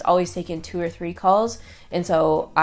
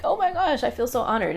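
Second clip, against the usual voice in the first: touched excitement; 6 semitones higher than usual. Retold or imitated. Moved reply to crying fan.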